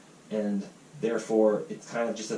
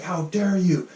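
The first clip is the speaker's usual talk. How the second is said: higher, louder indignant scolding; hammed-up outrage, wagging a finger at him; mock scolding; 8 semitones higher, 4 dB louder, slower than usual. Imitating the Canadian who scolded him, mock indignant. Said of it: "attacked me for daring question the doctor".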